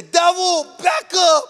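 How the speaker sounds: high, loud "Devil, back up" yelled out; mock scream. Preacher mocking believers who just yell, loud mock shout. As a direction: yell it like volume alone will win. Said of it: "How loud you scream".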